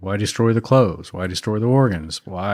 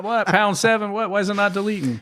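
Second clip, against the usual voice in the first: flustered caller; 9 semitones higher, 3 dB quieter than usual. Retold or imitated. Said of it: "They're hitting, like, zero".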